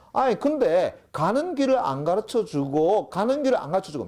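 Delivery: high, loud, exasperated complaint. Acted. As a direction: complain in disbelief that nobody gave directions, high and loud. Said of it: "황당해하며".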